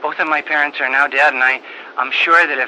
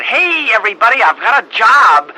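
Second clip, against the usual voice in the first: excited announcement; 6 semitones higher, 5 dB louder than usual. Retold or imitated. Mother announcing her new job.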